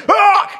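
loud, high mock roar; re-enacted scream of lost temper. Pastor screaming at a little dog, overblown fury. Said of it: "I'm screaming at this little".

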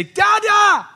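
put-on high, loud little boy crying for his dad; imitating a toddler yelling from his bed; child calling out. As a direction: shout it like a small boy calling Dada in the night. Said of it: "when I hear my son Liam say".

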